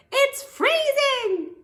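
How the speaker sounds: very high, slow, loud shout. A narrator's reading. Froggy shouts into the doctor's stethoscope. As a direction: bellow it into the stethoscope. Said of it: "shouted into it".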